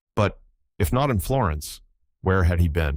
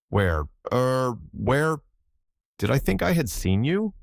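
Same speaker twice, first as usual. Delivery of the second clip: nervous stammer; 5 semitones higher, slower than usual. A narrator's reading. Hunted secretary stammering.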